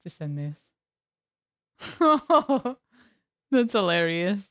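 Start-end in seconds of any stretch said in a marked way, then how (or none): 1.8-2.7 a loud high cackle; loud high-pitched laughter. Real, not acted. Burst of laughter.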